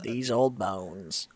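low, slow, lightly voiced old-timer; slow, low groan of age; weary old bones. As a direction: drag it out like a tired old soul. Wearily echoes old bones line.

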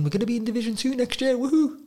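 high, fast fake celebration; mock cheer. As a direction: pretend it's all amazing, way up high.

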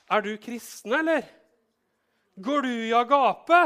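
high, loud incredulous asking; can't believe what they're hearing; probing question. Acted. Modern person questions listener. (høyt, vantro spørsmål)

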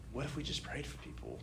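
quiet, tentative retold idea; soft suggestion. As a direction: float it softly, almost to yourself.

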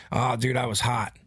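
normal talking voice

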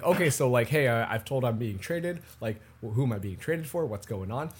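a bewildered athlete mumbling questions; confused traded player. Acted. [name] voiced, confused about trade.

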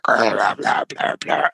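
low, growling monster gibberish. Retold or imitated. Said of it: "he has this monster voice".

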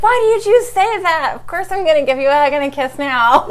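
high, loud imitation of indignant kids; protesting kids. Mother mimicking her guilt-tripped kids giving in, loud high protest. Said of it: "And they're like".

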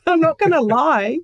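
laughing through the words, pitch up; laughing admission of exhaustion. Real, not acted. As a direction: giggle it out while admitting it.

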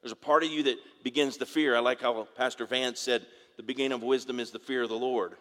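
ordinary delivery

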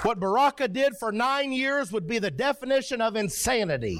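high, driving pulpit declaration; impassioned preaching. Acted. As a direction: preach it high, stretching the last line. Preaching at full force.

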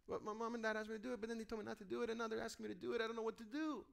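put-on soft, quick, bewildered kid's voice; confused child. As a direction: mumble fast like a lost little kid caught between parents. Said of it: "if you're a child and if your parents confuse you".

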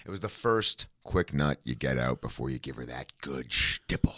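low breathy whisper; hushed, naughty aside. Real, not acted. Crude joke whispered.

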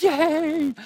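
put-on high squeaky child's "Yay!"; little boy shouting yay; excited kid cheer. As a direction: cheer like a thrilled five-year-old.

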